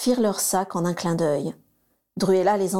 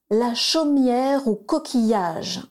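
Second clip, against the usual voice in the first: slow and proud, a little higher; strong clear voice; 4 semitones higher, much slower than usual. A narrator's reading. Bellatrix says the travel words, strong and slow. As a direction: call it out firmly, proud of the task. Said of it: "s'exclama Bellatrix d'une voix forte".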